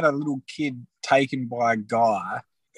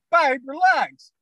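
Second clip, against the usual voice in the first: acted husband; 13 semitones higher, slower than usual. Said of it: "I'm like".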